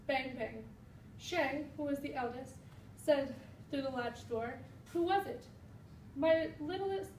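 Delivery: put-on lively read-aloud to children, each line shaped; storybook reading. A narrator's reading. Teacher reading a fairy tale aloud to her class, animated storybook voice. Reads Chinese version to class.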